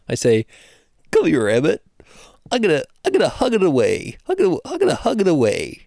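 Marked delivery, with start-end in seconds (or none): 1.1-5.8 high, fast, playful jester voice; sing-song fool coaxing a child to a hug; court jester. Retold or imitated. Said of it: "I put on my jester's voice".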